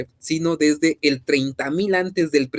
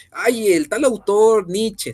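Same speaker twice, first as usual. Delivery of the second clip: mocking tone; 7 semitones higher, 4 dB louder, slower than usual. Mocking the idea of a single author.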